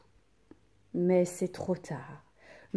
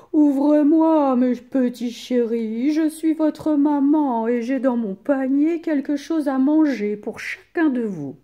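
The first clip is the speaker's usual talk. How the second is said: a wolf loudly putting on a mother's voice; wolf at the door; 6 semitones higher, 7 dB louder than usual. A narrator's reading. Wolf calling to the kids through the door, loud fake-sweet voice. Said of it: "Il sonne à la porte et dit".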